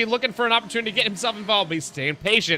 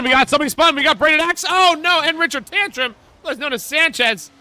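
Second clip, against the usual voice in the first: higher, louder alarmed commentator shout; urgent excited call; 9 semitones higher, 5 dB louder than usual. Real, not acted. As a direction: raise it as the spin sinks in.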